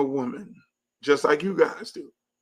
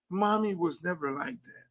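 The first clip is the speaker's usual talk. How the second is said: ordinary delivery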